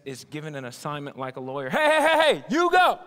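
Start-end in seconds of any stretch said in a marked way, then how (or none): 1.7-3.0 loud, high "hey, hey, hey"; loud, high shout to get his attention; loud call-out. Acted. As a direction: call him out sharply across the room. Pharisees calling out to Nicodemus.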